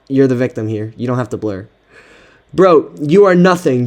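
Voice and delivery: ordinary delivery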